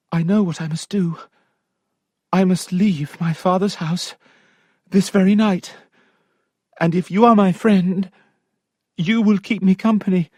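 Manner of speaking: high, resolute Sophia; lightly voiced resolve; determined young lady. Sophia asking her maid to run away with her, high and firm.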